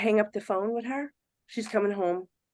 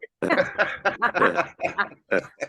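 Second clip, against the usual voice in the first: laughter. Real, not acted. Laughing at herself.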